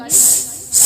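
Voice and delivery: س hissed sharply with no voice; whistling hiss. Shown as a demonstration. Teacher showing the whistle of seen with a hiss. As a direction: make a whistling س sound between the teeth. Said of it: "سیٹی کی آواز نکالیں گے".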